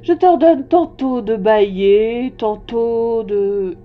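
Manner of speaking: loud, slow, dragged-out stammer; pompous king losing the thread; spluttering vexed king. A narrator's reading. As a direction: flounder loudly through a decree, stretching words. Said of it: "Il bredouillait un peu et paraissait vexé".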